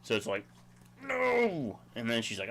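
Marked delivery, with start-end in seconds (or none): 1.0-1.8 a sharp, much higher "No!"; a big animated no for the dog; mock scolding cry; emphatic no. Shown as a demonstration. Signing no to deaf dog.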